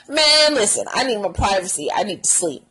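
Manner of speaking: normal talking voice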